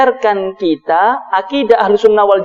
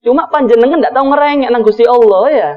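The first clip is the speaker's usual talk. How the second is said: ordinary talk, nothing marked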